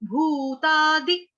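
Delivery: भूतादि stretched very slowly with a long भू; slow teacher demo of भूतादि; slow syllable demo. Shown as a demonstration. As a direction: draw out भूतादि, holding the long u. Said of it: "छोटी की मात्रा".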